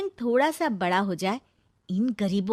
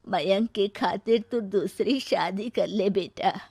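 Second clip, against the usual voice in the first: gentle mother; slower than usual. Acted. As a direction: soothe your son slowly. Mother consoles son slowly.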